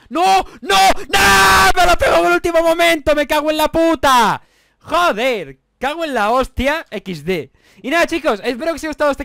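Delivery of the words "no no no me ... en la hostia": much higher and louder, raging yells; furious shouting. Real, not acted. Gamer tagged at the very last moment, real furious shouting. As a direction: explode at losing at the last second.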